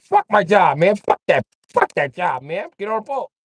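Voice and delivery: imitating Chuck ditching work; mock fed-up quitting.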